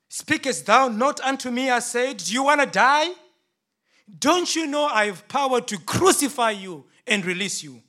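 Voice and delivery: high, loud and demanding; angry demand. Acted. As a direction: shout at a silent prisoner to make him speak. Pilate confronts silent Jesus.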